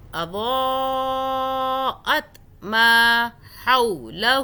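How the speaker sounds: اضاءت ما حولہ chanted very slowly, ما held long; Quran recitation. Shown as a demonstration. Teacher recites the verse for students, slow chant. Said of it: "ماں کو کھینچا".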